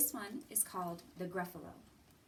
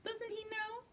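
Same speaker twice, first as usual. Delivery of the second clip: much higher, softer playful question; mock disbelief; 13 semitones higher, 5 dB quieter than usual. A narrator's reading. Narrator laughing at the owl, high and soft. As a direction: tease the silly owl.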